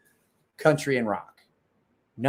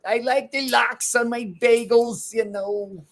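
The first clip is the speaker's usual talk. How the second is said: higher, slower put-on Fauci voice; Anthony Fauci impression; 10 semitones higher, 3 dB louder, slower than usual. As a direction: drawl it out like Fauci. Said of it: "So that was my Anthony Fauci".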